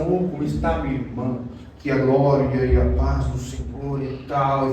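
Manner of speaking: lightly voiced churchy welcome; mock pious greeting. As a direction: imitate the expected holy salutation. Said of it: "Ele não dá aquela saudação".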